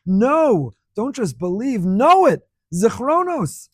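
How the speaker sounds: emphatic exhortation. Real, not acted. Preacher urging firm conviction.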